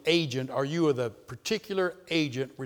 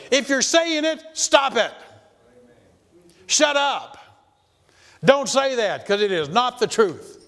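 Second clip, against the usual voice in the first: loud, high scolding; blunt rebuke; 7 semitones higher, 6 dB louder than usual. Acted. Preacher rebuking believers who blame God, loud high scolding. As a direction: tell them flat out to shut up. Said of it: "Or you could put it this way".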